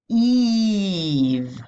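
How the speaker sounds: "Eve" with a drawn-out "ee"; long E sound. Shown as a demonstration. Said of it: "That's the long E sound".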